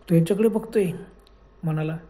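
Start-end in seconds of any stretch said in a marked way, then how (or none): none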